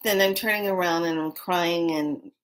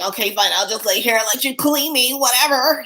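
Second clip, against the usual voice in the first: higher, louder put-upon pet giving in; resigned pet voice; 7 semitones higher, 6 dB louder than usual. Pretend. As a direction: voice the pet that gives up and lets you groom it. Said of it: "like, laying".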